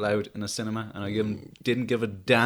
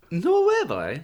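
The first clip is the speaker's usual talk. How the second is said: mock Cork accent, leaping high then dropping; hammed-up Cork lilt; 8 semitones higher, slower than usual. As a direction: play up the Cork accent with a big pitch swoop. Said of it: "with your fearful Corky accent".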